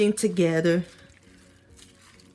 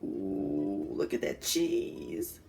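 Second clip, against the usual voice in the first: hushed whisper; 10 dB quieter, much slower than usual. Real, not acted. Tasting, hushed awe.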